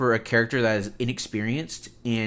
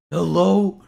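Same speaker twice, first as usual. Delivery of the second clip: loud higher hello into the mic; calling out; 8 semitones higher, 10 dB louder than usual. Real, not acted. Streamer calling into a dead mic, loud hello. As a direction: check if anyone can hear. Calling out when audio fails.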